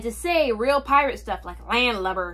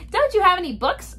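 put-on higher-pitched child's question; boy's voice; 8 semitones higher, 3 dB louder than usual. A narrator's reading. Boy asks pirates for bedtime books, high child voice. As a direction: ask it high, as the young boy. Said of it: "wrong voice".

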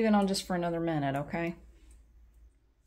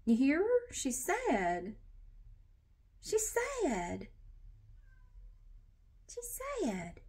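higher with each she's sad, softer each time; pleading pet voice; 10 semitones higher, 6 dB quieter, slower than usual. Pretend. Voicing a pet begging to let the cat in, rising high soft pleading.

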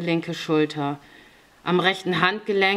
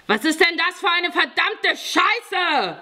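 high-pitched furious yell; enraged, high-pitched bellow; angry roar; 12 semitones higher, 3 dB louder than usual. A narrator's reading. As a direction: roar in outraged disbelief. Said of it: "brüllte Fjodor".

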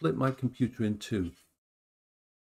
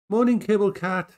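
higher, louder greeting call; cheerful greeting; 8 semitones higher, 8 dB louder than usual. Real, not acted. Owner greeting her cat, high and loud. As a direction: sing out a hello to the cat.

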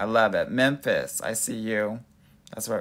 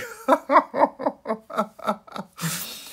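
long, high-pitched cackle of laughter; 12 semitones higher than usual. Real, not acted. Amused at viewers acting out already, a high laugh.

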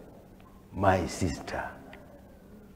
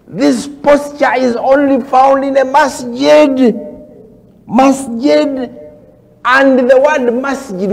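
high, loud, ringing preacher's declaration; emphatic preaching; 12 semitones higher, 8 dB louder than usual. Real, not acted. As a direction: proclaim it loudly to the congregation. Preacher proclaims the point emphatically.

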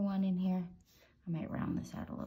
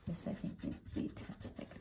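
softer, faster whisper to herself; whispered aside; 9 dB quieter than usual. Real, not acted. Drawing tutor whispering a note to herself as she works. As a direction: breathes the words out quickly, unvoiced.

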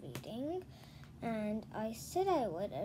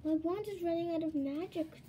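higher, louder anxious plea; worried gasp; 6 semitones higher, 4 dB louder than usual. Acted. Joy to the girls, her magic failing: anxious gasp. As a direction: gasp it out in worry. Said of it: "Joy gasped".

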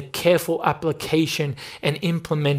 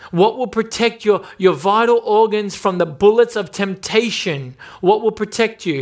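higher, louder rhetorical questions; preaching at full force; 5 semitones higher, 4 dB louder than usual. Acted. Preacher asking what guards against temptation, raised and urgent. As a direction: fire the questions at the flock.

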